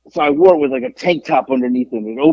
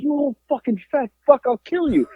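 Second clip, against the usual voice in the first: acted angry threat; 10 semitones higher than usual. Drunk man held back from the biker, acted angry threat. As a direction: hurl a retold threat, voice pitched up.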